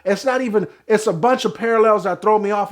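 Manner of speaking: normal talking voice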